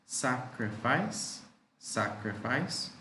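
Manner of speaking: sacrifice drawn out to show three syllables; teacher stretching out sacrifice; slow, syllable by syllable. Shown as a demonstration. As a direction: model it slowly for the class. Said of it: "Three syllables there".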